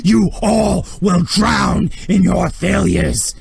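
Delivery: slow, high, roaring condemnation; furious shout. Acted. Thrawn raging at all his watching officers, furious slow shout. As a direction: bellow each word at the whole room.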